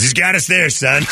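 a hint of a chuckling father conceding; fan's amused dad. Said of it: "He goes".

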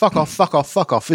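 mock Australian girl cursing; a hint of an Australian tic; imitating an Australian accent. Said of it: "she sounded like she was Australian".

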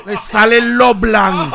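high, loud, slow radio host voice; Franklin Virgo impression. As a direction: boom it out like a cheesy radio host. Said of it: "Franklin Virgo sí lo". (imitación de locutor agudo y lento)

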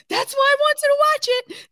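very high squealing laughter; hysterical laughing. Real, not acted. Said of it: "now I'm hysterical laughing".

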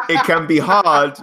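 laughing through the words, drawn out; cracking up mid-sentence, slowly. Real, not acted. Laughing while a co-host tells him to stop. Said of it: "[name], stop laughing".

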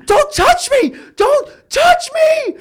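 high, loud screeching repeat; mock hysterical woman. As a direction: shriek it like a panicked stranger. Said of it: "she's like".

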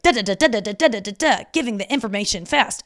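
quick, punchy "da da da" rattle; mock fast talker rattling nonsense syllables; rapid-fire babble. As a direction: imitate someone firing off information fast. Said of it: "I like people who are like".

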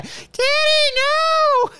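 very high drawn-out mock wail; movie clip imitation. Mimicking recognizable audio from a prank film clip, high wail. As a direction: mimic a child's cry in falsetto. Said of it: "Just hearing that".

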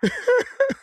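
high-pitched laughing. Real, not acted. Prankster hearing his victim tell coworkers, laughing.

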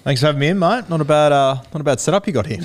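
high, loud, cheery hello; loud excited greeting. Real, not acted. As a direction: greet him big and bright. Guest greets host enthusiastically.

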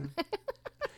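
light high giggle; giggle, touched; high gentle giggle. Real, not acted. Laughs after compliment.

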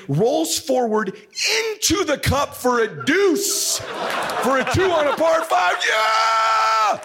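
building high to an exultant yell; triumphant shout. Retold or imitated. Dad telling of son's deuce, triumphant shout. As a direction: call the miracle, ending in a whoop. Climax of miracle shot story.

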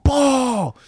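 a sharp, high cry of "Ball!"; excited yell. Retold or imitated. Fan yelling at the TV over the fumble, high and excited. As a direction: scream it as the fumble happens. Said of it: "I'm yelling".

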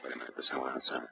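hushed, breathy warning; whispered alarm; urgent whisper. Acted. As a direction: keep it down so the intruder can't hear. Hears someone approaching the house.

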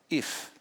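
a soft, heavy "If." left hanging; stressed If. Acted. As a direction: say "If." quietly and let it sit. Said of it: "It's only two letters".

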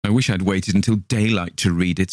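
ordinary delivery, nothing marked

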